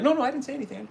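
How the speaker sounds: high, nervous backpedal; sheepish denial. Pretend. Chaos agents denying to the Most High, high and nervous. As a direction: squeak it out guiltily. Intruders backing off.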